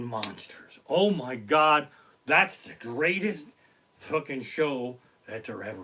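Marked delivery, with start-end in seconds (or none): none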